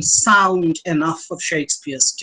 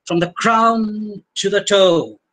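"crown" and "toe" drawn out long; slow line, stretching "crown" and holding "toe"; dragged open vowels; 3 semitones higher, slower than usual. Shown as a demonstration. Lecturer showing the length from head to toe, "crown" and "toe" dragged. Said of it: "open vowel sounds that may be dragged".

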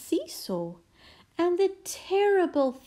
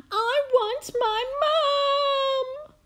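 very high, loud, drawn-out bawl; 6 dB louder, slower than usual. A narrator's reading. Princess left with the maid, bawling for mum. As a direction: bawl it like a small child. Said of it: "bawled the little princess".

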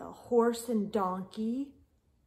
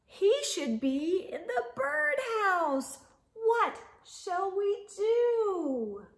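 higher, louder read-aloud, slow on the question; sing-song the refrain for little ones; storybook refrain; 10 semitones higher, 8 dB louder, slower than usual. A narrator's reading. Reader asking the children what to do, sing-song.

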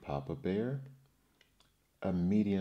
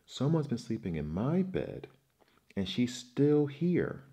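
little bear screaming higher and louder; Baby Bear scream; 6 semitones higher, 4 dB louder than usual. A narrator's reading. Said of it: "screamed Baby Bear".

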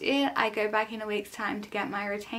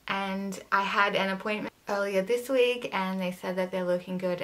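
talking through metal braces; speaking with braces; 2 semitones lower than usual. Real, not acted. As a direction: orthodontic brackets on teeth. Seen 2: Metal braces visible on teeth whenever the speaker's mouth opens.

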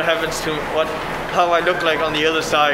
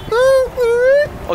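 high excited woo; cheering; 6 dB louder than usual. Real, not acted. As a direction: whoop it up high. Cheering again.

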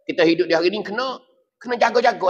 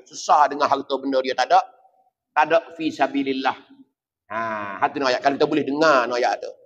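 normal talking voice

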